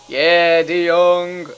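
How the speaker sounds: real elated yell; goal celebration. Real, not acted. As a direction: shout the scorer's name in triumph.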